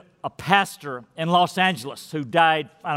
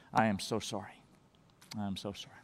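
contrite apology; 9 semitones lower, 14 dB quieter, faster than usual. Pretend. Confessing to one's spouse.

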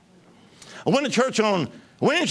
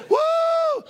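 ecstatic whoop. Real, not acted. Drunk in the Holy Ghost.

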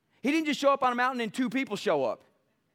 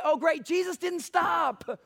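higher, slow, exaggerated satisfaction; mock cheerful; 4 semitones higher, slower than usual. Preacher mocking readers who stop early, hammed up. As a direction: ham up the smug reader. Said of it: "we read the Lord's Prayer and then we stop".

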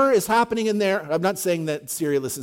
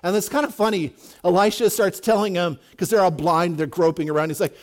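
ordinary talk, nothing marked

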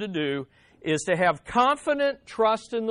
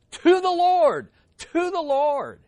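"to the Lord" high and forceful, then repeated slower; "to the Lord" proclaimed, then driven home; emphatic TO THE LORD; 8 semitones higher, 5 dB louder than usual. Shown as a demonstration. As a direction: urge "to the Lord" twice. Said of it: "I like the emphasis of that".

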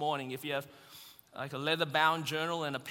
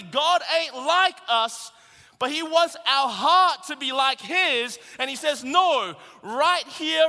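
loud rising sermon climax; fiery preacher, building loud; preaching at full force; 11 semitones higher, 12 dB louder, slower than usual. Real, not acted. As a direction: drive it home to the congregation.

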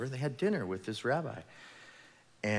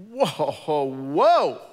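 loud, higher cry of protest; shocked whoa; 6 semitones higher, 12 dB louder than usual. Acted. Pharisees protesting the woman at Jesus' feet, loud shocked whoa. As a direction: shout whoa at the scene. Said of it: "these guys are like".

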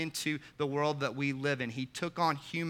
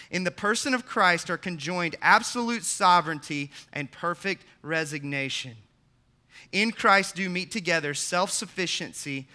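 rising, ringing preacher's read, louder and higher; preaching at full force; 6 semitones higher, 8 dB louder than usual. Acted. Pastor reading Edwards to the congregation, preaching with mounting force. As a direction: build the quote into a soaring proclamation.

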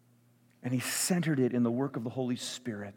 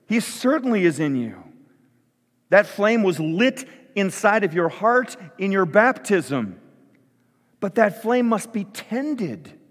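loud, higher-pitched preacher driving it home; emphatic preaching; 6 semitones higher, 7 dB louder than usual. Real, not acted. Pastor assuring his congregation the Spirit is in them, emphatic preaching. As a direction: preach it out with force.

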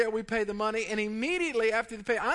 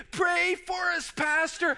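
panicked plea; 7 semitones higher, slower than usual. Retold or imitated. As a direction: beg for prayers mid-rapids. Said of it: "he's looking, he goes".

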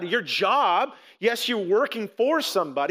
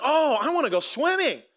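higher-pitched imitation of an eager kid; lightly hammed-up kid's excitement, pitched up; excited daughter; 6 semitones higher than usual. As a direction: mimic a child pleading to go swimming. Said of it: "my daughter got up, she goes".

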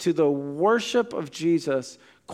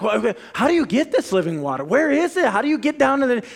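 higher and fast, tripping over her words; baffled woman; 7 semitones higher, faster than usual. Retold or imitated. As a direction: rush out the questions like a confused woman. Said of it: "so she's talking like".

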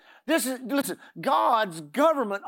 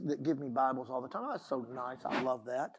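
ordinary talk

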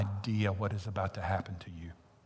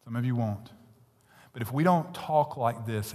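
ordinary delivery

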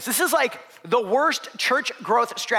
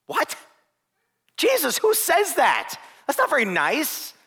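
high, slow mock indignation; mock shock; 9 semitones higher, 3 dB louder, slower than usual. Preacher mock-scolding Jesus for a harsh reply, high and slow. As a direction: play the scandalised listener.

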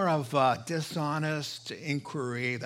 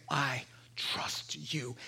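slow, voiceless whisper, much softer; whispered confession; 7 dB quieter, slower than usual. Acted. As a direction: breathe it out in simple faith. Sinner's simple confession.

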